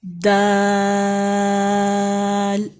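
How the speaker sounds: letter names held long in a chant; drawn-out recitation. Shown as a demonstration. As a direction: chant each letter slowly. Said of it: "اور اب آج کون سا پڑھیں".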